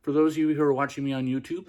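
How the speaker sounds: normal talking voice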